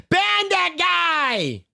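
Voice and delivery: high, drawn-out outraged cry; mock outrage, stretched high and slow. Real, not acted. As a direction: demand he be banned.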